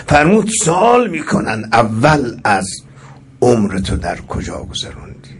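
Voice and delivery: ordinary talk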